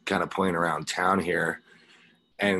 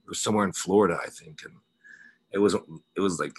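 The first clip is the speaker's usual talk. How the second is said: ordinary talk, nothing marked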